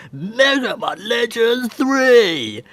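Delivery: loud, high, drawn-out proclamation; stretched-out excited shout of a favourite title; excited announcement. Real, not acted. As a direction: proclaim it big and slow, voice soaring. Triumphantly announcing his cancelled-game pick.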